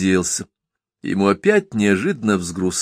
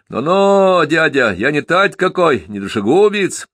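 shouting; 7 semitones higher than usual. A narrator's reading. Runaway lad denying he is a murderer, shouting. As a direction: yell it to deny the charge. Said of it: "закричал Стёпка Рочев".

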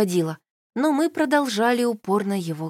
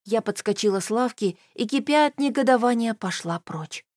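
usual speaking voice

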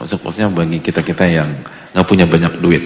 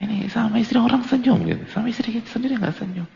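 mock scolding aside; 13 semitones higher, 4 dB quieter than usual. Menirukan sindiran.

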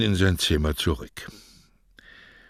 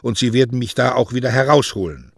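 resolute; 6 semitones higher, 4 dB louder than usual. A narrator's reading. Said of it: "sagte er entschlossen".

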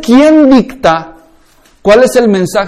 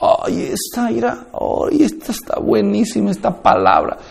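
soft, breathy mock rapture; hushed awed murmur, poking fun; mock mystical hush; 9 dB quieter than usual. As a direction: imitate someone lost in a private moment with God. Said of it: "experiencia mística donde tú estás con Dios y dices".

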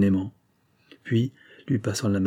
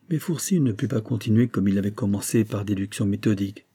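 ordinary delivery, nothing marked

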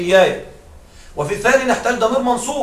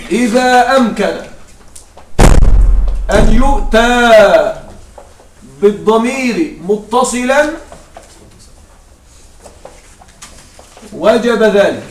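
slow, deliberate dictation; slow rule recital; 4 dB louder, much slower than usual. Shown as a demonstration. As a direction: rule dictated slowly, word by word. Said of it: "القاعدة تقول".

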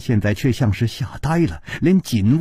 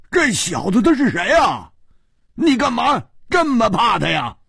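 high, incredulous character voice; indignant killer; 10 semitones higher than usual. Acted. Killer asks why his partner fears him, indignant. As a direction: protest in a high, strained pitch.

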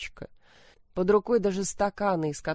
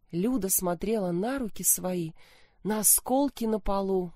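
usual speaking voice

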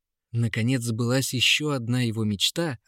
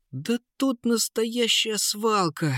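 high, slow astonished cry; exclaiming; 11 semitones higher, slower than usual. A narrator's reading. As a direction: exclaim in wonder. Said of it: "воскликнул Крит".

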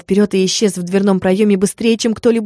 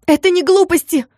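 much higher, shrieking protest; shrill scandalous yell; 10 semitones higher than usual. A narrator's reading. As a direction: shout back in a hysterical fit. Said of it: "скандально выкрикнула Мотя".

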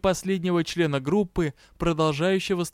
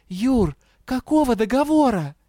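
higher-pitched, bewildered question; puzzled question; 7 semitones higher, 4 dB louder than usual. A narrator's reading. Confused Дэя asks her partner what contract, bewildered. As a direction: ask it high, at a loss.

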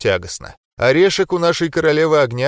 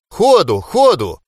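loud urging coach shout; coach yelling; 6 semitones higher, 6 dB louder, slower than usual. Acted. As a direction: cheer him on like a coach. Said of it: "орёт взбадривающие слова поддержки".

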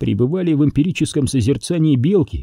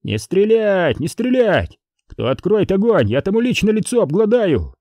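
high, called-out command with a threat; urgent order; 8 semitones higher than usual. A narrator's reading. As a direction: call a ceasefire to your own men. Officer orders his men not to fire. (приказ высоким голосом, с угрозой)

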